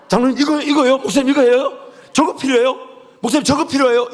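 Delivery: high, loud, rapid Gyeongsang-accent badgering; pushy Gyeongsang elder. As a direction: pepper him with questions like an impatient Gyeongsang elder. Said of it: "우리 경상도 장로님들은 미칩니다".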